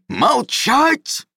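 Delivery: high, raging bellowed "Молчать!"; spitting shout. Acted. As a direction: roar "Молчать!" hard enough to spray spit. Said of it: "брызнул слюной Гавран Бадюлевич".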